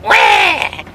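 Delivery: high, loud baby wail imitation; mock wail. Dad copying the baby, a high loud wail. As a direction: wail way up like a screaming infant. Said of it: "And I'm like".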